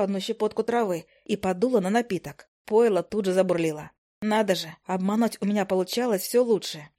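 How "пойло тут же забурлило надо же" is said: plain reading voice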